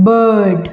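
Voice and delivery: bird with long अ, no r sound; elongated a in bird. Shown as a demonstration. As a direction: stretch the अ in bird, drop the र. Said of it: "यहाँ पे आप ध्यान से सुनिए, मैं र को उच्चारित नहीं कर रही हूँ". (bird में अ को लंबा खींचें, र नहीं)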